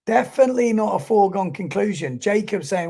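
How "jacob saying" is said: ordinary talk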